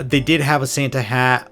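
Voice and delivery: ordinary talk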